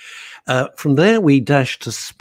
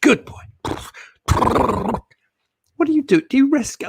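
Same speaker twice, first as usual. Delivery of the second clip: very high, cooing pet voice; light baby-talk voice to a pet; doggy baby talk; 14 semitones higher, faster than usual. Acted. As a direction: coo at the animal on screen. Talking to a horse on camera.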